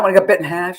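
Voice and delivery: ordinary talk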